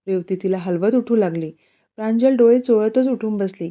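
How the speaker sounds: usual speaking voice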